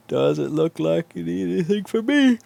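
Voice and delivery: high, cooing voice to the laptop; mock asking the laptop. Coaxing the laptop in a high sing-song. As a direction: pretend to ask the broken laptop if it needs you.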